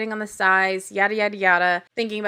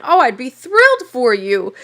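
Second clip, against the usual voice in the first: louder, gushing mock enthusiasm; mock thrilled; 8 dB louder than usual. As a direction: imitate someone overeager to agree. Mocks Steve's eager breakup reply.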